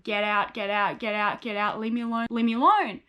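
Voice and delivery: ordinary delivery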